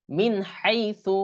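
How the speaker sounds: slow, drawn-out "min haythu"; clear "min" before ha. Shown as a demonstration. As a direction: model "min haythu" with clean n. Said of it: "মিনটা মিন উচ্চারণ হলো". ("মিন হাইসু"-র নুন স্পষ্ট)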